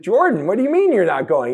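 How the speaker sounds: loud, high mock disbelief; incredulous parent. Parents questioning their eldest son's refusal, incredulous. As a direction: challenge the kid, pitch jumping up.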